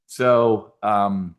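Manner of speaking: usual speaking voice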